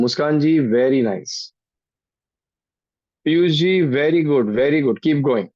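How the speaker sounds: normal talking voice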